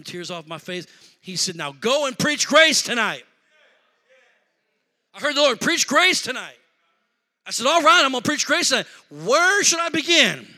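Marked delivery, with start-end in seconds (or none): 1.6-10.5 rising preaching. Acted. Preaching builds again.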